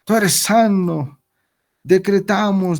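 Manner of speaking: high, very slow mocking proclamation of tú eres sano; hammed-up decree, each word dragged out; drawn-out mock decree. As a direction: stretch "Tú" and "sano" like a showy healer. The mock decree repeated, slower.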